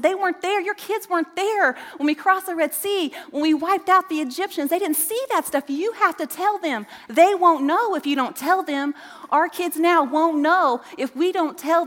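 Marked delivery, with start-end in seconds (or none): 0.0-7.9 higher-pitched, urgent retelling of Moses; Moses exhorting the people. Retold or imitated. Preacher voicing Moses warning the people, urgent and raised. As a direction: lift the voice and urge like Moses. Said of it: "He said".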